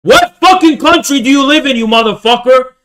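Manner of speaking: angry yelled challenge; yelling demand. Real, not acted. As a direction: shout the question at him. Demanding answer from chatter.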